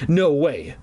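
ordinary delivery

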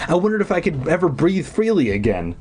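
ordinary delivery

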